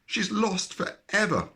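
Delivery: high wailing lament. A narrator's reading. Rabbits wailing for their lost sister. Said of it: "wailed the rabbits".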